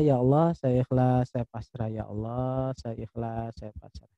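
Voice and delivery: prayer. Shown as a demonstration. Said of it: "Anda ikuti saja".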